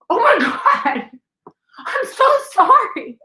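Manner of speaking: high, slow, laughing gasp of sorry; shocked apology. Retold or imitated. Apologizing after hitting woman.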